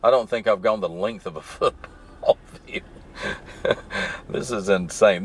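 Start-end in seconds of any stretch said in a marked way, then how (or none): 1.4-5.2 laughing mid-sentence in disbelief. Real, not acted. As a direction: let a helpless laugh break into the words. Laughs at how little he has moved.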